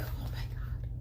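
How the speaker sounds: whispered oh my God. Real, not acted. Dreading the trip planning.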